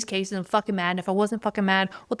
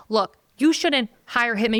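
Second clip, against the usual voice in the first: ordinary talk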